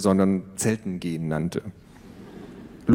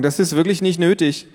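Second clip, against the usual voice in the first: higher, louder embarrassed protest; teen daughter waving off her parents; annoyed teen; 8 semitones higher, 4 dB louder than usual. Acted. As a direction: brush it off like a mortified teenager. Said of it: "rollte mit ihren Augen".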